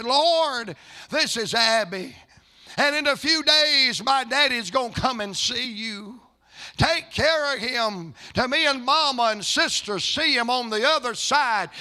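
high, loud, fervent retold prayer; child's prayer. Five-year-old prays for dying father, high fervent plea. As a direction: call out to the Lord as the little girl. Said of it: "Abigail prayed".

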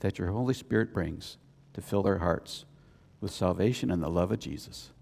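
soft, low closing prayer; hushed low prayer voice to God. Acted. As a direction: pray it quietly and reverently.

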